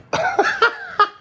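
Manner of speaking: bright high burst of laughter. Real, not acted. Laugh heard.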